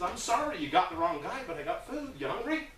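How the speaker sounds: high-pitched apologetic offer; retold friendly offer. Volunteer offering the shouting man food, apologetic.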